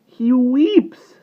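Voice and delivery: high, loud, pitying cry; high, anguished insistence; distressed girl. A narrator's reading. Ariel pities the weeping vampire.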